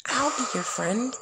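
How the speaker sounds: put-on high, small girl's voice; a sweet pony filly offering friendship; little filly voice. A narrator's reading. Filly tells the lonely man she'll be his friend, in a high little voice. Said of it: "She looked up at the man and said".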